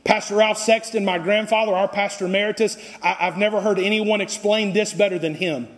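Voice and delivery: ordinary talk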